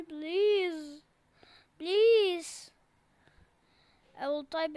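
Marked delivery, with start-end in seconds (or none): 0.0-2.7 louder, pleading cry of please; desperate plea. Pretend. Stuck in the game, he loudly begs other players for help. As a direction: beg loudly like you're about to lose.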